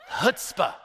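attempted Hebrew accent on chutzpah. Shown as a demonstration. Preacher attempting a word heard from his pastor, Hebrew accent. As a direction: chutzpah with a throaty Hebrew opening sound. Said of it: "I can't even do the accent".

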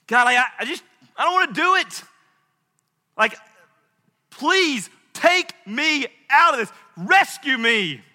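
high, loud, halting plea; desperate begging. Acted. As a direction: cry out for rescue, word by word. Begging God for rescue.

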